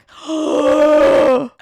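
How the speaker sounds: loud, long shocked gasp; offended gasp. Retold or imitated. Mother affronted by daughter's request, loud offended gasp. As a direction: gasp as if deeply insulted.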